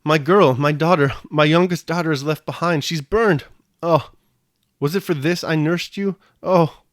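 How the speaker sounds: high, loud wail of a mother's grief; keen over the lost child; tearful lament. A narrator's reading.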